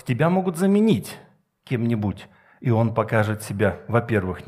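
ordinary delivery

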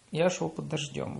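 ordinary talk

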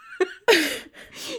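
shrieking laughter; high laughing fit. Real, not acted. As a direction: cackle with delight. Reacting to explosion news.

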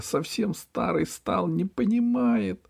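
high, slow fretting; upset. Acted. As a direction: lament the old man. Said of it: "расстраивалась Речка". (высоко, огорчённо)

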